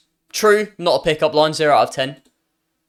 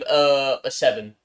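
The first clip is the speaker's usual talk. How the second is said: ordinary talk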